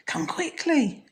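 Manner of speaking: high, slow calling. Acted. Wife calling her husband to come quickly. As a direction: call him urgently from the other room. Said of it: "she called her husband".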